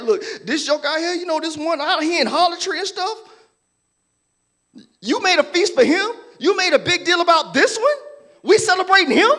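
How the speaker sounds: high, loud, indignant complaint; resentful older brother. Retold or imitated. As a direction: protest the party for the sinner. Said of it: "He's like".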